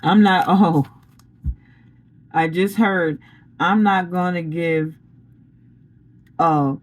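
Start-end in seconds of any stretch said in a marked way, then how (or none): 3.6-6.8 slow, deliberate delivery with weighty gaps; measured pronouncement. Retold or imitated. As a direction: pause between phrases as if passing on a decree. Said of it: "That's the way he just said it".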